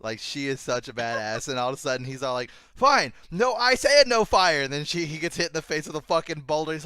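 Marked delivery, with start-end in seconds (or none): 2.7-4.6 high, loud, slow yell; hammed-up indignant fighter; mock exasperated shout. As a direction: drag out the fed-up order loudly. Retold opponent's exasperated order.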